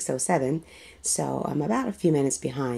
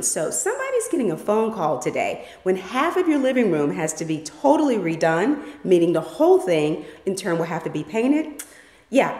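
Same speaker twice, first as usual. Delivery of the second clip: ordinary talk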